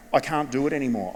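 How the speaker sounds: ordinary talk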